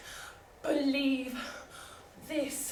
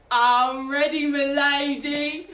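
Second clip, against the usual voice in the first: put-on lower class accent; 8 dB louder, much slower than usual. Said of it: "in that disgusting lower class accent".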